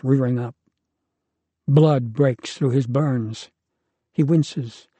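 ordinary delivery

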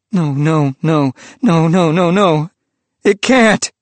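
rising desperate shouts of no; anguished cry. Acted. Priest collapsing before the vision, crying out in anguish.

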